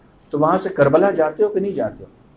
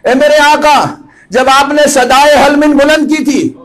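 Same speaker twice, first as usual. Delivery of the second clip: high, loud impassioned address to the Imam; full-force preaching; 9 semitones higher, 5 dB louder than usual. Real, not acted. Preacher speaking to his master Hussain, impassioned and loud.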